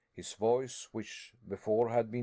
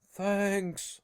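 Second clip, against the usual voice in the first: higher, louder burst of thanks; grateful cry; 8 semitones higher, 4 dB louder than usual. A narrator's reading. Harry to his uncle, crying out thanks. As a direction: cry it out in relief. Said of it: "I cried".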